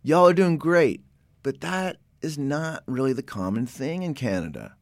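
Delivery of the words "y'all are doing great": louder, higher Oklahoma-style cheer; cheering y'all. Retold or imitated. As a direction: hype up the kids the way he was raised to. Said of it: "The way I would really like to do it".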